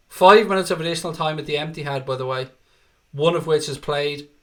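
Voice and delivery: ordinary delivery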